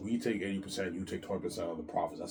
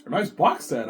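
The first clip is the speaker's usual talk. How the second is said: higher, louder box-set cheer; Peter's voice; 6 semitones higher, 9 dB louder than usual. Retold or imitated. Peter praising the box set, higher and louder. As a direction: pitch it up, delighted.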